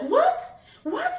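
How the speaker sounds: high, puzzled echo of "What?"; confused what. Retold or imitated. Strangers in the snow, baffled and calling back high. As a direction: ask it like you can't make out a word. Locals not understanding their accent.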